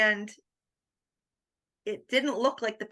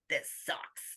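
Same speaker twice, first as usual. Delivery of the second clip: hushed, sulky complaint; grumpy whisper to her husband at the door; whispered grumble; 3 semitones lower, 10 dB quieter than usual. Retold or imitated. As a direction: breathe it out like a sulking kid.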